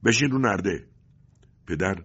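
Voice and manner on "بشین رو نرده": loud, raised command; shouted order. A narrator's reading. Agent orders father onto railing, shouting. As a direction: yell an order at a prisoner. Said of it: "فریاد کشید".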